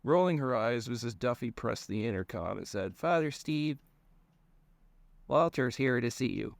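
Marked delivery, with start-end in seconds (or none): 3.0-6.6 higher-voiced Irish secretary; Irish accent. A narrator's reading. Secretary announcing a visitor over the intercom, Irish accent. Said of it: "she just, uh, developed an Irish accent".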